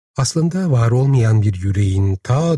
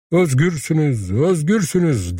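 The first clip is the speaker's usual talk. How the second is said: higher, ringing shout of liberation; raised, rousing call; crying out; 6 semitones higher than usual. A narrator's reading. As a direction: fling the words out to the crowd. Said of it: "diye haykıran Vahşi".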